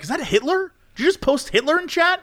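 high-pitched, incredulous questioning; exaggerated scandalized query; mock alarm. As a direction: act out appalled disbelief in a high voice. Acting out his alarmed streamer reaction.

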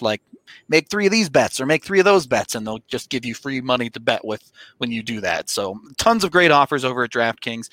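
upbeat sponsor ad read. Acted. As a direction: sell it like a radio spot.

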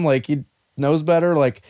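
usual speaking voice

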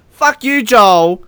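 high, loud telling-off; indignant outburst. Real, not acted. Telling off commentator Joel for his remark, indignant and high. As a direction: snap it out, pitched right up.

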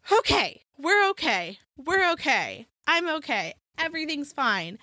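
high-pitched talking yourself down; sigh, then reassure yourself in short high phrases; unconvincing, high-voiced "I'm fine" routine; mock self-reassurance. Mock self-soothing after anxious rant.

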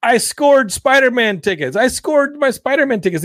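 high, giddy fan yell; mock excited yelling. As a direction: gush like a fan who just scored tickets. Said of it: "people are yelling".

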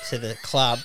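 usual speaking voice

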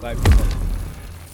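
slow, pointed whisper. Acted. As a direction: breathe it out slowly and knowingly. Repeating a word for a joke.

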